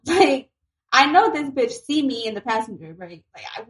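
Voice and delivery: usual speaking voice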